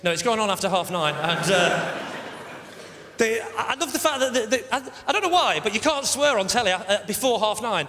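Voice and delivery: ordinary delivery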